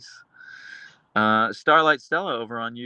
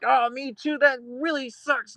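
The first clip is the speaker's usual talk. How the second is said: much higher, slow, hammed-up pity; mock sympathy; 12 semitones higher than usual. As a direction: coo it like you're parodying yourself. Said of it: "I'm like".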